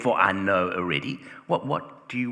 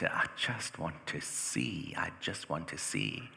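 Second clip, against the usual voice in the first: 1 quiet plea; 3 semitones lower, 8 dB quieter, slower than usual. Acted. Blind man asks Jesus to see.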